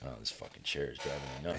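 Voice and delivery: fast, soft annoyed mutter; muttered aside. Real, not acted. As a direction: grumble it under breath. Annoyed aside about chair.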